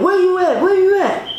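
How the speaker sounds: high-pitched, insistent questioning; retold frantic demand. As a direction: act out an ex hounding by phone. Ex blowing up her phone.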